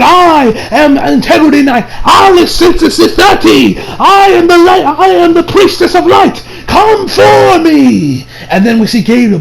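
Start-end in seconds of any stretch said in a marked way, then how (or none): 0.0-8.3 grand declaration. Retold or imitated. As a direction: announce yourself like a holy knight. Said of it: "announces to her that".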